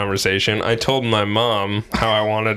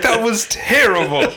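imitating an outraged mother, much higher and slow; hammed-up furious mother, pitched up and laughing; mock indignant mom; 13 semitones higher, 3 dB louder, slower than usual. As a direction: drag it out like a scandalized mom. Mom scolding the prank caller.

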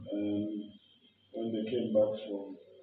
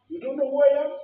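much higher and louder, outraged disbelief; offended big shot; 14 semitones higher, 8 dB louder, faster than usual. Retold or imitated. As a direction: play the insulted former minister at the counter. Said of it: "This guy took offense".

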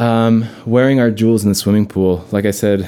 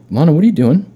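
high, louder, mock big sister; scolding sister; 9 semitones higher, 4 dB louder, faster than usual. Older sister scolding Lana at the pool. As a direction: call her out like a bossy sister. Sister voiced in imagined scene.

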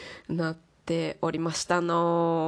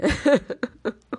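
loud high laugh; 8 semitones higher, 8 dB louder than usual. Real, not acted. Laughing at own complaint.

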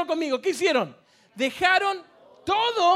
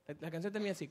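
ordinary talk, nothing marked

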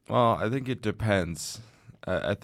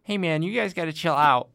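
higher, louder casual suburban Midwestern bro voice; mock suburban Midwestern accent; suburban Midwestern dude; 8 semitones higher, 4 dB louder than usual. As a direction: play a chill suburban Midwestern guy. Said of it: "Like that kinda".

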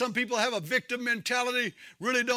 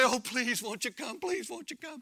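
very soft, feeble begging; mock pleading; 7 dB quieter than usual. Preacher imitating a timid altar call, mock pleading. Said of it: "we have these altar calls and we say".

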